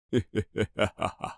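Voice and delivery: low, soft villain's laugh; sinister chuckle. Acted. Unseen man laughing at his victim in the dark, low and sinister. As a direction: chuckle low and slowly menacing.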